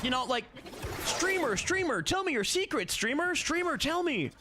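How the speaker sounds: high, pleading little-creature voice; hammed-up pestering falsetto; mock wheedling voice. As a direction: beg the streamer for secrets, way up high. Teasing chat with a mock voice.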